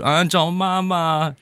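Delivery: high, slow whine for mum; put-on bawl, vowels stretched; mock child's wail. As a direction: imitate schoolkids crying out when hurt. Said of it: "都会这么喊".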